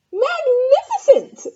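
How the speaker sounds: very high, slow, laughing praise; gushing praise. Acted. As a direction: overdo the admiration with a laugh. Elizabeth praises dragon.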